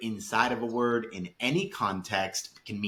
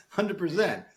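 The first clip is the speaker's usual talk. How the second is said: laughing; 8 semitones higher than usual. Real, not acted. Laughing repeat.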